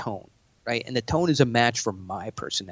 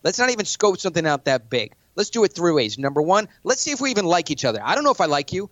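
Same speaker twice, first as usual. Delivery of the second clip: higher and pushy, jabbing questions; aggressive challenge; 4 semitones higher than usual. Shown as a demonstration. To a billionaire in a pitch meeting, a pushy challenge. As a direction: corner them and demand an answer. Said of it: "pointing at a billionaire".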